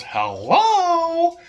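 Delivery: a parrot squawking hello from the floor. Retold or imitated. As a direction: play the parrot greeting, bright and piercing. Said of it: "and she goes".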